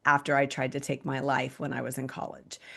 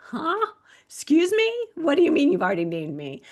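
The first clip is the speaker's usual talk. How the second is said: incredulous; 11 semitones higher, 4 dB louder than usual. Retold or imitated. Stunned reply to God's answer.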